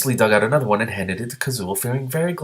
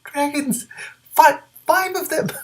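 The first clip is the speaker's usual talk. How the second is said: much higher squeal of delight; excited exclamation; 3 dB louder, slower than usual. Real, not acted. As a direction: gasp it out like a thrilled fan. Reader reacts to dragons appearing.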